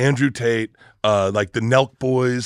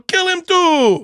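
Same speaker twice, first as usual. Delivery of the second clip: high, slow mock crowd order; 4 dB louder, much slower than usual. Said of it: "And they go".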